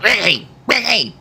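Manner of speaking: high calling out a name across the yard; imitating a mother calling her son home; mom calling out. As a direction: yell it like a mom at the door.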